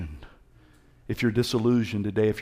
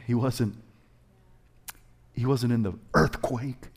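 usual speaking voice

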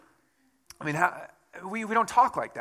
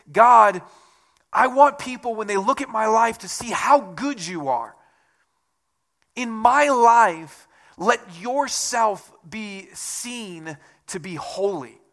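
louder, higher, pleading prayer voice; fervent prayer; 5 semitones higher, 6 dB louder, slower than usual. Acted. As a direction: pray it out with fervour. Voicing a heartfelt prayer.

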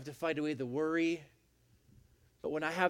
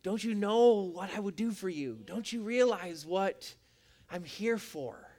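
tender; 4 semitones higher than usual. Acted. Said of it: "Jesus' response is always".

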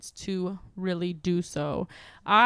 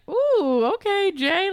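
excited; 10 semitones higher, 5 dB louder, slower than usual. Retold or imitated. Retelling own excited reaction.

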